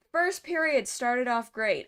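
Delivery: loud, drawn-out sarcastic praise; slow it down with loud, sarcastic enthusiasm. Real, not acted. Host recapping the first period to viewers, a little sarcastic. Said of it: "I'm being a little sarcastic here".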